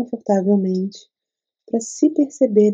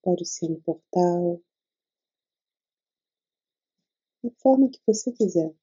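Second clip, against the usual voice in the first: guided meditation. Acted. Leads a meditation.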